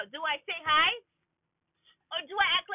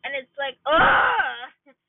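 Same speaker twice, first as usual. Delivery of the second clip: frustrated groan; 8 dB louder, much slower than usual. Real, not acted. Venting frustration about waiting.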